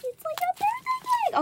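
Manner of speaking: squealing high with delight; thrilled high-pitched squeal; excited. Real, not acted. Delighted gasp at slime.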